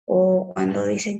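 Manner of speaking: normal talking voice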